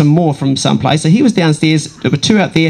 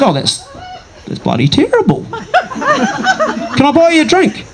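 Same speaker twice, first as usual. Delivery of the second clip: put-on Kiwi accent; 13 semitones higher, slower than usual. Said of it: "in my Kiwi accent".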